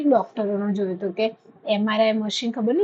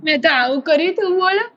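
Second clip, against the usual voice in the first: laughing; 6 semitones higher than usual. Real, not acted. Laughing at her own panic.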